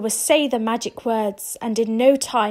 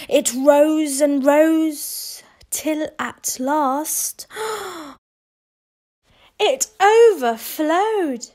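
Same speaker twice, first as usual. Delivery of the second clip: building suspense; 4 semitones higher, 3 dB louder, much slower than usual. A narrator's reading. Building suspense as porridge rises.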